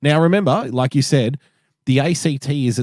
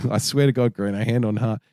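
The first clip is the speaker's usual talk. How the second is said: ordinary talk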